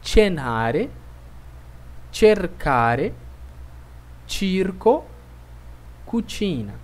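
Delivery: cenare, cercare, circo with a che/chi sound; Italian C demo. Shown as a demonstration. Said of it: "pronunciadas da seguinte forma".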